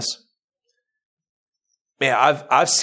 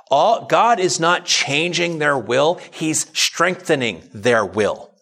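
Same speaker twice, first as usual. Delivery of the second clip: high, slow, emphatic preaching voice; 5 semitones higher, slower than usual. Acted. Pastor preaching to his congregation, slow and emphatic. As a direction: drive each point home to the congregation.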